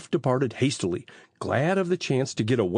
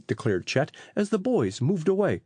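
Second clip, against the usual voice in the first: ordinary delivery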